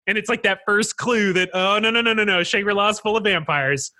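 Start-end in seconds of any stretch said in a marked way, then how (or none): none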